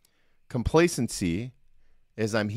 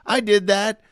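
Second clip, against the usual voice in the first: higher, louder mock-smug gloat; 7 semitones higher, 5 dB louder than usual. Coach voicing a complacent person to listeners, hammed-up smug gloat. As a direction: gloat over your own win, hammed up. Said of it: "I'm kinda smug about it".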